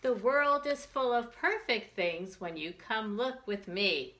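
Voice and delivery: usual speaking voice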